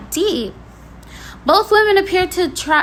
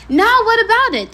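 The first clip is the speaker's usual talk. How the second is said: defiant; 7 semitones higher, 5 dB louder than usual. Real, not acted. Defiant challenge to critics.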